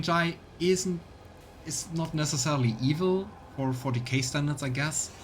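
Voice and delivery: ordinary talk, nothing marked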